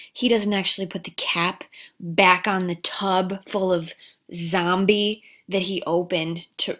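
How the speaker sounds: ordinary delivery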